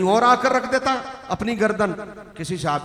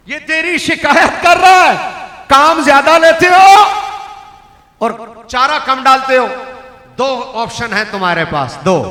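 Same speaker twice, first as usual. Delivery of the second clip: loud, higher, chuckling delivery; raised preaching voice; 5 semitones higher, 9 dB louder than usual. Acted. Preacher retells the Prophet scolding the owner, loud. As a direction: call it out to the crowd.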